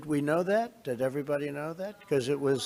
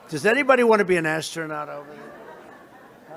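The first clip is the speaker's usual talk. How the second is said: ordinary talk, nothing marked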